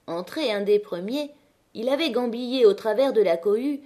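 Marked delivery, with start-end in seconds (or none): none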